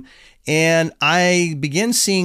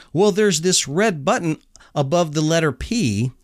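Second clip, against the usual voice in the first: ordinary delivery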